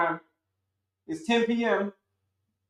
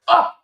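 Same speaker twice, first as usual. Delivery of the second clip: short loud cry of alarm; startled cry; 10 semitones higher, 7 dB louder than usual. Retold or imitated. Waking in panic at night, a startled yelp. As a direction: yelp awake in fright. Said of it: "you're gonna wake up like".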